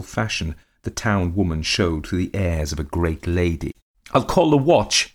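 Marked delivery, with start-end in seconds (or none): 4.1-5.1 high, loud shrill cry; shrill it out to scare him off; shrill threat. A narrator's reading. Countess threatening her husband with the watch, shrilling. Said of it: "she shrilled".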